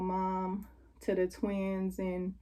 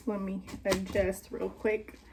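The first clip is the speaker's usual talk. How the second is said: normal talking voice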